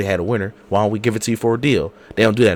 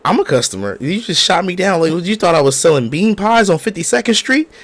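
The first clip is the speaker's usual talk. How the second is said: high, louder stunned protest; stunned disbelief; 10 semitones higher, 5 dB louder than usual. Retold or imitated. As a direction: sputter in indignant disbelief. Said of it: "I was stun locked because I'm like".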